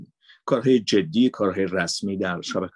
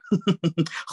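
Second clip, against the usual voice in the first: host laugh; 9 semitones higher than usual. Shown as a demonstration.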